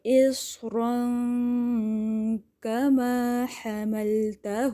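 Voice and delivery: very slow chanted verse; Quran recitation. Shown as a demonstration. Teacher recites the verse to the class, chanted. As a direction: draw each syllable out melodically. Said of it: "اسی طرح کی کاف کی sound آئے گی".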